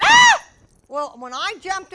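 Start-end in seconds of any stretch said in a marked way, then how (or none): 0.0-0.4 high loud startled scream; a driver screams as a car bears down. Retold or imitated. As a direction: shriek in sudden fright. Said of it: "she went".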